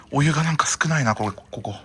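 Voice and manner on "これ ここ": ordinary talk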